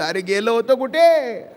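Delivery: ordinary talk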